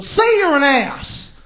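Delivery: slow, drawn-out TV-host command; mock impression of a pushy advice guru; Doctor Phil. TV host pressing his guest, slow mock insistence. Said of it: "he's like".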